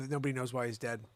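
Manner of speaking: ordinary delivery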